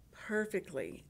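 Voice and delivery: ordinary delivery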